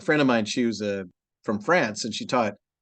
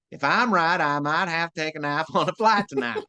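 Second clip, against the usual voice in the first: higher-pitched, broad Texan with flattened I vowels; sister's Texan accent; 5 semitones higher than usual. Retold or imitated. Imitating the sister reading the practice line, thick Texan. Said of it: "I had my sister read that".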